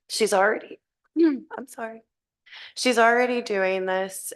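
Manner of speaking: hoarse, strained voice; losing her voice. Real, not acted. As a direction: speak through a failing hoarse voice. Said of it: "I'm losing my voice".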